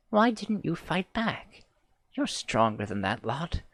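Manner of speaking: slow tender reproach; gentle chiding. Acted. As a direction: grandmother soothing the girl.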